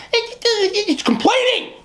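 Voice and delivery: high, drawn-out complaining impression; mock whining. Imitating her complaining to her coworkers for an hour, mock whining. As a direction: whine it out as a slow, high caricature. Mimics woman's endless complaining.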